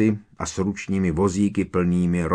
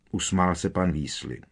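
plain reading voice

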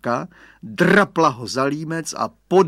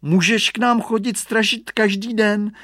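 put-on high, raised child's voice; little boy; 8 semitones higher, 5 dB louder than usual. A narrator's reading. Radovan invites Hugo to scare birds daily, boyish. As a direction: chatter it like a young boy.

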